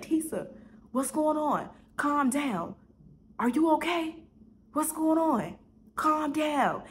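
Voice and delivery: high-pitched mock yell, little extra volume; imitated yelling. Man to Tisa crying on the phone, imitated yelling. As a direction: urgently call to someone hysterical. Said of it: "And he's yelling, yelling".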